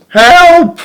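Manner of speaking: high, loud cry for help; cried out. A narrator's reading. Beetle on its back cries out for help, high and loud. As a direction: yelp it out, stuck on its back. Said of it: "it cried".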